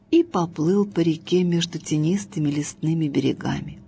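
plain reading voice

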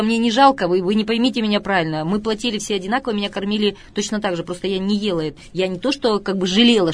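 ordinary delivery

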